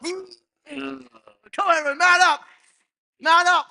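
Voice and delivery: loud high chant; rally the whole room; rallying shout. Pretend. Host leads man up chant.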